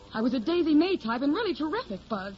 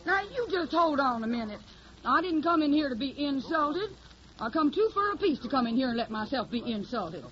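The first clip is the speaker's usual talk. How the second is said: put-on hillbilly accent; 3 semitones higher than usual. Hildy poses as a Tennessee country girl, in a put-on Southern accent. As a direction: play the naive Southern hillbilly singer. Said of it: "I got the technique down pat".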